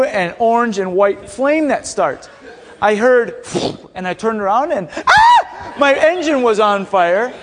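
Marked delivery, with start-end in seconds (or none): 4.9-5.7 short, high-pitched yell of alarm; mock panic shriek; startled scream. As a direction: scream as if suddenly seeing flames. Sees his engine on fire.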